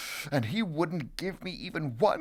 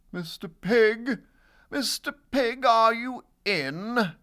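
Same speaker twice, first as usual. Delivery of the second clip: higher, sing-song call at the door; 8 semitones higher, 3 dB louder than usual. Acted. Wolf calls on the second pig, calling out. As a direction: call in to see who is home. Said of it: "I called".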